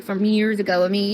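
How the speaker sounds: ordinary delivery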